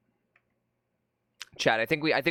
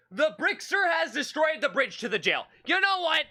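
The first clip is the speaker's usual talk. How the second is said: exasperated outburst; 10 semitones higher than usual. Real, not acted. Exasperated at game setback.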